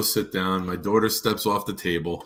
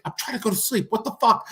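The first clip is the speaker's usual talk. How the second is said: much higher voice, annoyed complaint; imitating his daughter; 12 semitones higher, faster than usual. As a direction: mimic a sleepy kid snapping at the noise.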